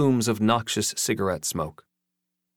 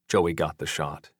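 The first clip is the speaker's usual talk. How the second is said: plain reading voice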